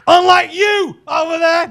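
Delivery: high, loud, slow jab; calling out. Real, not acted. Comedian to audience member mid-story, calling out. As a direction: holler it across the room.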